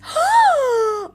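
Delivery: a loud, high, startled gasp; sharp gasp. Real, not acted. Streamer spotting a shocking clue, gasping loudly. Stunned by the search result.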